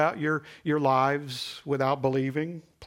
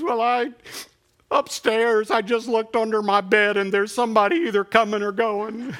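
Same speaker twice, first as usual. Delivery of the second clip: put-on much higher and louder, a breathless child's report; excited little boy; 7 semitones higher, 7 dB louder than usual. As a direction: blurt the punchline up high like a wide-eyed kid. Boy's punchline in joke.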